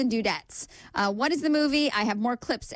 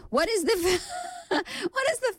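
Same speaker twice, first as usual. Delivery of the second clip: laughing; 11 semitones higher, faster than usual. Real, not acted.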